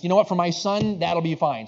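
loud, resolved husband; acted emphatic giving-in; rallying acceptance. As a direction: come round to it, loudly. Husband gives in for his son.

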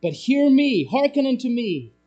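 high, loud summons in imitation; calling out. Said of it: "He's calling out".

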